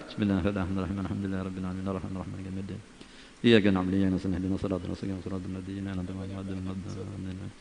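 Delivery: soft fast murmured Fatihah; rapid Fatihah recitation. Acted. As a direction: murmur the Fatihah quickly under the breath. (membaca Al-Fatihah cepat dan lirih)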